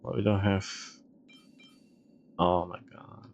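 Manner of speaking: normal talking voice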